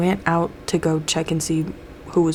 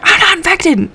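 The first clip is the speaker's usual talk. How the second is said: much higher, louder denial; defensive shout; 12 semitones higher, 6 dB louder than usual. Retold or imitated. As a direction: snap back defensively. Mulder defends himself angrily.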